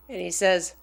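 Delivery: normal talking voice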